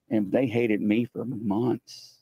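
plain reading voice

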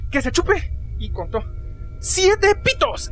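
imitación exagerada, aguda y muy alargada; mock outburst, stretching the words out high; compañero indignado. Imita el arrebato del compañero. (compañero indignado, imitado con exageración)

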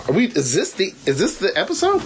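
normal talking voice

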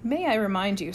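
plain reading voice

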